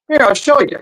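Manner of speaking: high, bright character voice for the driver; truck driver voice. Acted. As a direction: play the driver eager to show off.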